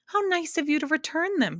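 high, bright-pitched happy exclaiming; thrilled to have her sounds back; happy cry. A narrator's reading. The Soundkeeper to the Din, crying out happily. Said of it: "she cried happily".

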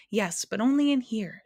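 plain reading voice

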